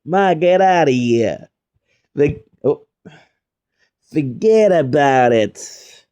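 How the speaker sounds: slow, drawn-out Italian-American "ma, get outta here"; Italian-American accent. Retold or imitated. Teasing a guest, an Italian-American accent. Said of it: "I don't know if you're Italian".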